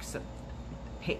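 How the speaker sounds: plain reading voice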